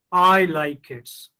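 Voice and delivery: put-on loud, long "I", then "like kids" falls away; stress on I. Shown as a demonstration. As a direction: hit "I" hard to mean me personally. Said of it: "But in the third one".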